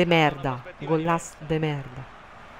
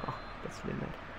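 whispered curse; 16 dB quieter, faster than usual. Real, not acted. Muttered curse at player.

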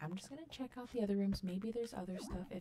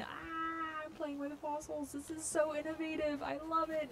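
high, gushing play-along squeal; pretend delight; 8 semitones higher than usual. Gamer pretending to play with fossils, giddy delight. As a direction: act thrilled, higher than usual chat. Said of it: "play around here and pretend".

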